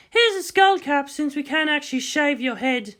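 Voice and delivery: high, light female voice, teasing compliment; Kodama's voice. Acted. Kodama to Makoto in costume, high playful approval. Kodama hands skull cap.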